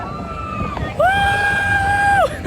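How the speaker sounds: high shrieking laughter; roller coaster laugh. Real, not acted.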